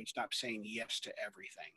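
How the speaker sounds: hushed whisper. Retold or imitated. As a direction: whisper it to your wife. Telling wife quietly at home.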